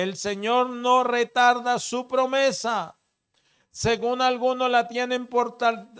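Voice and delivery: loud, high, driving pulpit voice; preaching at full force. Acted. Pastor preaching to the congregation, loud and fervent. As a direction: preach it out to the whole church. Preacher expounds scripture loudly.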